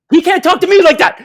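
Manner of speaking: high, loud, laughing protest; indignant shout. Retold or imitated. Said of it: "I'm like".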